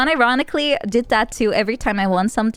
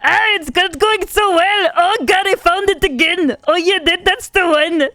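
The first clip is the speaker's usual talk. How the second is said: louder, much higher hammed-up German accent; a terrible German Terminator impression, loud and proud; bad fake German accent, slow and loud; shitty German voice; 5 semitones higher, 5 dB louder, slower than usual. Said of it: "impersonate Olivia doing a Gunther voice".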